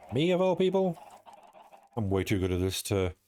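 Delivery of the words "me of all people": high, sarcastic squeak of disbelief; hammed-up high-pitched mock offense; mock indignation. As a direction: play the wronged innocent, hammed-up. Mock outrage at being cancelled.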